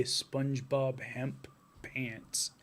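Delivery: breathy slow whisper. Real, not acted. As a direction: whisper it slowly. Joking aside whisper.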